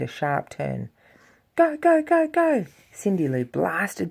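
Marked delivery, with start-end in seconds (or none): 1.5-2.7 high, loud go go go; excited urging. Acted. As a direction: cheer yourself on downhill. Racing downhill on snow tube.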